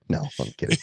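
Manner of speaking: usual speaking voice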